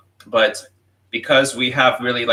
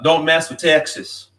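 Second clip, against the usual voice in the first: put-on slow, higher Texan accent; slow Texan accent, pitched up; 7 semitones higher than usual. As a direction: lean into a Texas accent, slowly. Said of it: "That's a, like, a Texan accent".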